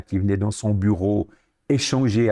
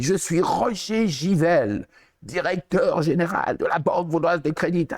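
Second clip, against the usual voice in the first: imposing director voice; 7 semitones higher than usual. Retold or imitated. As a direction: announce your name like the big boss of the bank. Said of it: "avec une voix, une intonation, là, imposant".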